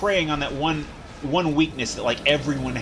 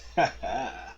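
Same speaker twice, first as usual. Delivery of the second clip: brief quiet chuckle; soft laugh; 3 semitones lower, 5 dB quieter than usual. Real, not acted. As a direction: laugh it off softly. After the shout.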